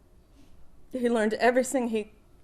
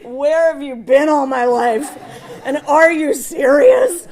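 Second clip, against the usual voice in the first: laughing quote; 4 semitones higher, 12 dB louder than usual. Retold or imitated. Quoting father's delighted reaction.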